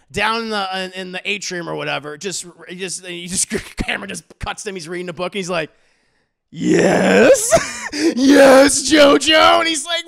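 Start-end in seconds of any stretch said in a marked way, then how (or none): 6.5-9.6 loud, drawn-out theatrical yes; over-the-top villain reply; mock villain. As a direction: answer with hammed-up villain relish. Acting villain's smug reply.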